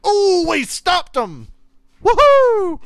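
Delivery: high, loud whoop of joy; yelling with delight as the stop happens; excited cheer. Real, not acted. Fan watching the game cheers the defensive stop, loud and excited.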